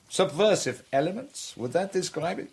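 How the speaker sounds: villain playfully feigning innocence; mock innocence. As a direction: tease him with false innocence. Villain mocking the hero's captivity.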